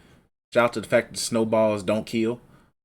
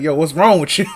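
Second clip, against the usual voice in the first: laughing mock alarm; 8 semitones higher, 6 dB louder than usual. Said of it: "he was like".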